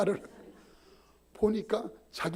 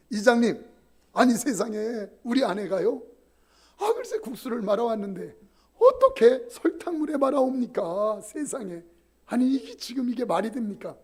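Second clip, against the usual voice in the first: raised, outraged husband pleading his case; indignant complaint; 5 semitones higher, 5 dB louder than usual. Retold or imitated. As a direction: protest loudly to the village head. Husband complaining to village head. (이장에게 억울함을 호소하는 남편, 격앙된 목소리)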